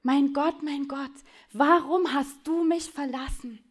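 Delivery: loud first call, then slow and pained; dying man's slow lament; anguished cry. Retold or imitated. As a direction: cry out in forsaken despair. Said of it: "Und er ruft".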